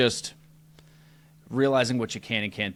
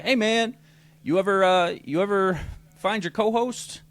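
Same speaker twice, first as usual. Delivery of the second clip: higher, breezy mock-casual ask; higher, offhand text to the boss; 9 semitones higher, 3 dB louder than usual. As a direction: play it cool so you don't sound like a beggar. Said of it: "really casually reached out to Steve".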